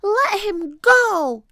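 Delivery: high, loud, drawn-out demand; indignant high-pitched holler; defiant shout. A narrator's reading. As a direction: yell it high and slow at a bully. Annie confronts the pirate.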